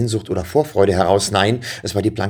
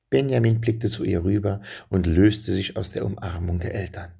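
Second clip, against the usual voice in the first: ordinary delivery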